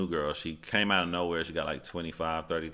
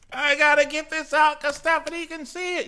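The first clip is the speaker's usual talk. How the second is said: very high, squeaky typing-troll voice; shrill cartoon troll rant; 8 dB louder than usual. Acting out an angry troll.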